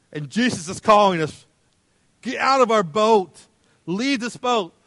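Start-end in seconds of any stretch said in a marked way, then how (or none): 2.2-4.7 high, loud summons; urgent command. Acted. Jesus is calling us out of the boat, urgently. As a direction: call them out with raised voice.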